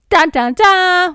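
loud, slow mock drumroll; dramatic sting. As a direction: hum a hammed-up reveal. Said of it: "we find out Noah is Aiden's dad".

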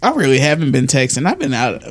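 ordinary talk

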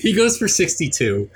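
struggling to hold in real laughter; after laughing hard. Real, not acted. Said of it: "let me compose myself here".

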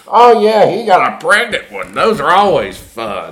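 high, loud mocking glee; gleeful host. Host gloating over a pregnant cockroach, high and loud. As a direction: crow it like a host enjoying the misery. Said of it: "all the guy goes".

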